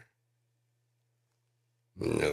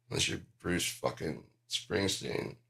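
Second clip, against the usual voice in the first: ordinary talk, nothing marked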